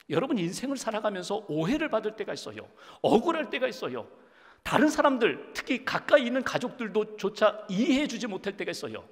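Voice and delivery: rising, emphatic preaching cadence; fervent sermon. Acted. Pastor preaching to his congregation about hurt, with rising fervor. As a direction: preach it to move the flock.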